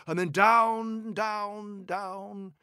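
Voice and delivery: slow, high, fading into the distance; falling away. A narrator's reading. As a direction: stretch each word and let it fade. Narrator mimes the troll falling.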